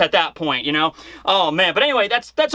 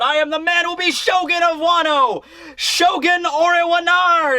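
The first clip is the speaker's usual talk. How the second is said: Momonosuke's yell; 9 semitones higher than usual. Retold or imitated. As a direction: proclaim it to the crowd, pitched way up. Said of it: "he yells that to the whole crowd".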